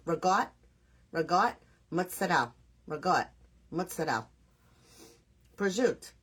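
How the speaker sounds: "Regot" as ruh-GOT, "Mozzarella" as mootsa-DELL, "Prosciutto" as pruh-ZHOOT; own dialect pronunciation. Shown as a demonstration. Teaching Jim if he's listening, "Regot", "Mozzarella", "Prosciutto" with endings cut off. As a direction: drop every final vowel in "Regot", "Mozzarella", "Prosciutto". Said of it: "that's how I talk".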